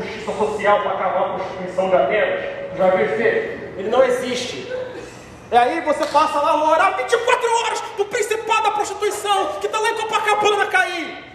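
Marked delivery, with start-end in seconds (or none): 5.5-11.2 mocking, higher and laughing; mock the silly prayer with a laugh. Mocking praying against Copacabana. (deboche rindo)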